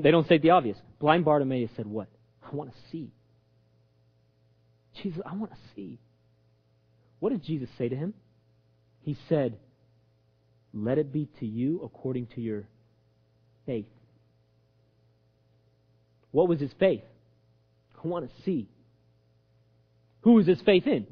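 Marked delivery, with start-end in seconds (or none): 2.4-6.0 very soft, earnest request; hushed plea. Acted. Blind man telling Jesus his desire, hushed. 10.7-13.8 low, hushed, measured blessing; gentle pronouncement. Acted. Jesus answering the blind man, gently. 18.0-18.7 soft, simple request; hushed plea. Acted. Blind man voicing his faith, hushed.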